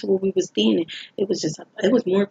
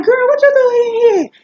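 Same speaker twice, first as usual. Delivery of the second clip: much higher and louder, astonished; shocked; 8 dB louder than usual. Retold or imitated. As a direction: act stunned to see her there. Acquaintance shocked to see her.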